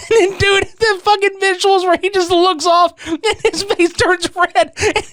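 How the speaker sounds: ordinary delivery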